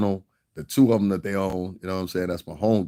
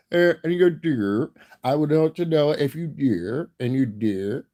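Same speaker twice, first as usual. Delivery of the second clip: high, drawn-out imitation Dutch accent; exaggerated Dutch-style vowels, higher voice; mock Dutch accent; 7 semitones higher, 3 dB louder than usual. Shown as a demonstration. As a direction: put on a comic Dutch accent, pitched up. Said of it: "Dutch people kinda talk like this".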